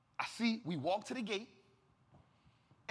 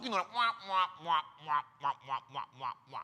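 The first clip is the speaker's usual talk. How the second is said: muffled trombone-like wah wah; Charlie Brown adult voice; 6 semitones lower, faster than usual. Retold or imitated. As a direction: imitate the droning teacher from Charlie Brown. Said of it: "it's the Charlie Brown talk".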